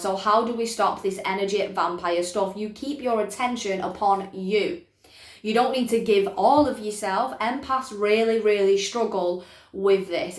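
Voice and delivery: ordinary talk, nothing marked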